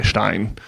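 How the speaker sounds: "Stein" said shtine, with the I sounded as in "fine"; shtine, teacher's demo; German Stein. Shown as a demonstration. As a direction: model "Stein" as shtine for a learner. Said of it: "you just pronounce the I".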